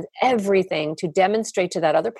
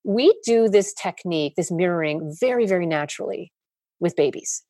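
ordinary delivery

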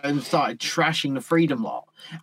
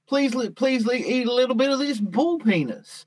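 presenter voice; 7 semitones higher than usual. Retold or imitated. Mimicking show host offering food.

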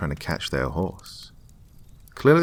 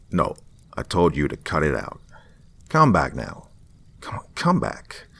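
mild mock shouting; 3 dB louder than usual. A narrator's reading. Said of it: "basically shouting into the phone".

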